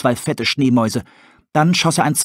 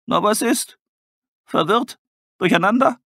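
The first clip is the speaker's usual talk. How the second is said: much higher, playful questions; teasing owl; 10 semitones higher than usual. Acted. Tully teasing the bone-gnawer, playful and high. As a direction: needle him with each word.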